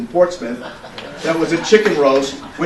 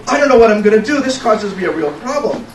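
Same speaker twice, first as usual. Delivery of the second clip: higher, rushed worrying; hammed-up dismay, tumbling out fast; mock fretful protest; 7 semitones higher, 4 dB louder than usual. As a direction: fret out loud, imitating a flustered friend. Acting friend's worried protest.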